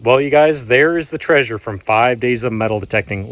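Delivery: ordinary delivery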